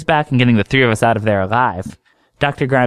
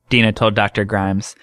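plain reading voice

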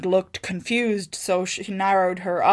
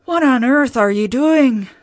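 loud, higher astonished cry; cried out; 5 semitones higher, 9 dB louder than usual. A narrator's reading. Wife startling her weeping husband, loud cry. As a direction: demand it across the ruins. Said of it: "she cried".

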